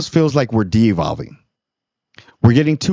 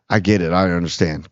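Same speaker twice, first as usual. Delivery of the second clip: reassuring; 6 semitones lower than usual. Retold or imitated. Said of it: "all you have to say is".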